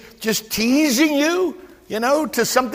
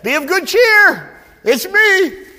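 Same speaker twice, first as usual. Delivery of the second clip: higher, louder, slow reassurance; voicing Jesus; 7 semitones higher, 4 dB louder, slower than usual. Jesus reassuring the disciples in the storm, high and loud. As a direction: call out comfort over the storm.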